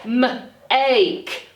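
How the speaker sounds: put-on blending M and ache for kids; sounding out. Shown as a demonstration. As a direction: say "M" then "ache" as separate sounds. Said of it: "starts with Marty Mouse's M".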